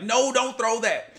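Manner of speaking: half-yell it like an alarmed coach; imitated coach yell. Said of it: "coaches would yell and be like".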